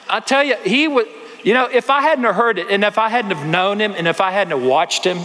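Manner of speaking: high, earnest amazement; the preacher marvelling at the story, high and insistent. Real, not acted. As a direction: press it home with wonder.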